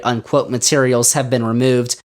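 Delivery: ordinary delivery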